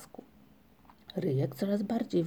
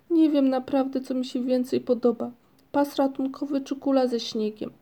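high, louder, helpless plaint; despairing; 5 semitones higher, 3 dB louder than usual. A narrator's reading. Ryjek cannot choose what he likes, despairing. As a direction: agonise aloud over the choice. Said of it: "powiedział Ryjek z rozpaczą".

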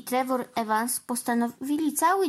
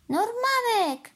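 high, long-drawn call of the name; mother's cry; 12 semitones higher, 3 dB louder, slower than usual. A narrator's reading. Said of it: "krzyknęła Dillis".

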